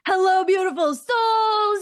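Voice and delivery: high, loud, bubbly hello to the room; mock loud actor greeting, pitched high; loud, space-taking greeting. Teacher imitates a loud actor greeting the room, high and loud. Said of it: "loud and taking up space".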